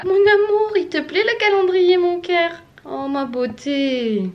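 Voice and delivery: high, cooing pet talk. Real, not acted. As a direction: coo to the cat. Talking to her cat.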